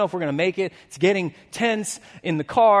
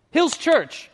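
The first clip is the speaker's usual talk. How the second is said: higher, louder call out to the church; rallying call; 9 semitones higher, 6 dB louder, slower than usual. Real, not acted. Pastor rousing the congregation at the close, loud and high. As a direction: lift the room.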